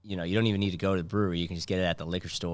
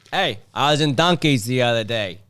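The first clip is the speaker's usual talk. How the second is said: mock Boston comic; 5 semitones higher, 7 dB louder than usual. Comedian voicing himself on stage.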